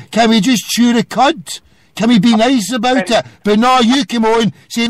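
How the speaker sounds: ordinary delivery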